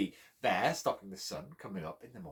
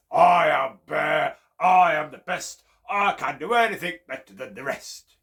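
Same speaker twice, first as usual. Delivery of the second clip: boasting bear; 4 semitones higher, 12 dB louder than usual. Acted. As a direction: boom it out like a proud bear. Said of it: "he would say".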